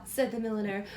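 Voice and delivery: bright, lively storybook reading; reading to children. A narrator's reading. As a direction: read it like bedtime story time for a little one. Reads picture book aloud.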